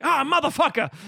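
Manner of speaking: caught-out curse shouted out; shocked yell. Retold or imitated. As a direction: yell as the trick blows up in his face. Knight finds Death in confessional.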